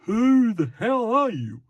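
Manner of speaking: high, loud, exaggerated demand; stock TV character. Shown as a demonstration. TV lawman challenging a stranger, high and hammed-up. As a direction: challenge the stranger like a caricature cop. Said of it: "a character that speaks like this".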